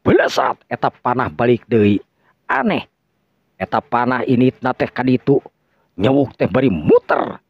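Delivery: usual speaking voice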